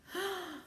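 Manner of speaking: sharp shocked gasp. Real, not acted. Reading to a child, gasping at the king. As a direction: gasp in surprise.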